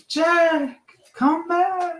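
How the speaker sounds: high pleading "Jack, come back"; mock plea.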